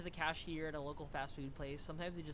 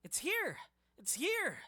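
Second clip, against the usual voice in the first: excited; 9 semitones higher, 6 dB louder, slower than usual. Acted. Excited discovery shout.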